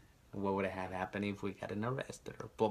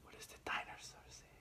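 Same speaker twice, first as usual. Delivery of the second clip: whispering; 18 dB quieter, faster than usual. Real, not acted. Whispered repeat to toddler.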